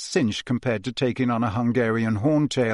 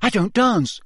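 quick; 8 semitones higher than usual. Acted. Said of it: "he said quickly".

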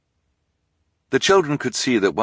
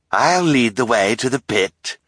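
scheming count; 6 semitones higher, 6 dB louder than usual. A narrator's reading. Villain announcing to crowd.

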